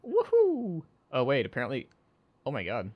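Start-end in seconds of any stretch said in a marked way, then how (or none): 0.0-0.8 high, whooping cheer; excited whoop. Acted. A fan cheering over a signed item, high excited whoop. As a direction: let out a high woohoo.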